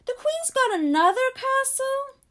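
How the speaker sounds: put-on a boy who can't believe the Queen has two castles; horrified surprise. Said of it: "looking horrified".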